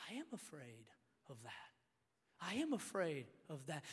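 very soft, low admission; quiet confession. Retold or imitated. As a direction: confess it softly, with space around it.

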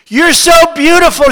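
high, loud recited exclamation; crying out. A narrator's reading. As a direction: cry it out as the poem's admiring woman. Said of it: "she cried".

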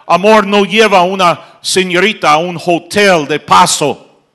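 fiery pulpit voice; preaching at full force. Acted. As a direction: thunder it at the congregation.

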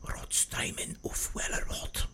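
slow, soft, menacing whisper; scary German whisper. Acted. Scary Nazi guy comes up next to you, slow whisper. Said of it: "scary, like, Nazi guy".

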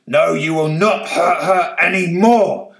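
butterfly Beth shouting defiance; shouted. Acted. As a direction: stand firm against the old man. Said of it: "shouted Beth".

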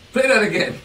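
thrilled, demand the replay; excited demand. Retold or imitated. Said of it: "He says".

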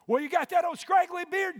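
preacher mocking a critic of his beard; mock sneering critic. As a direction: shout it like a scornful pew-sitter. Mocking judgmental churchgoer.

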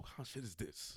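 low, soft disgruntled aside. Retold or imitated. Imitating Barry at the radio spot, low grumble. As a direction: grumble it low under the breath. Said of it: "Yo, he was like".

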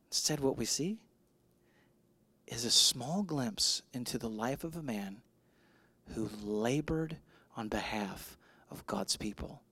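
low, soft, measured delivery with pauses; hushed preaching. Real, not acted. Pastor honoring a man who labored, hushed reverent preaching. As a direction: let it sink almost to a whisper by the end.

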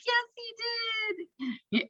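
very high, soft, drawn-out squeal; hushed high-pitched swooning; gushing fan reply. Retold or imitated. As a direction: coo it back at the commentator, high and slow. Acts out own gleeful reply.

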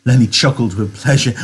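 ordinary delivery

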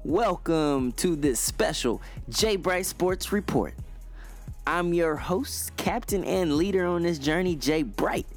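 big hyped show-host intro; booming motivational speech. Acted. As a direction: pump up the crowd like an announcer. Host opens his sports show.

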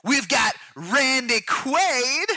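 high, drawn-out announcer hype; mock TV promo announcer. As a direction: sell the new show with big energy.

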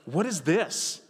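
What is this? with a curious rise on is; mild mock bewilderment; puzzled question. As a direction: sound baffled at the strange stuff. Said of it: "but, like".

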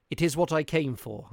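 bold reply. A narrator's reading. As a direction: answer with firm resolve. Narrator answers boldly.

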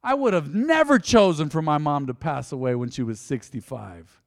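ordinary talk

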